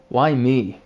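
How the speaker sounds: despairing outburst. Real, not acted. Detective alone after the murder, despairing outburst. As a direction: throw it at the sky.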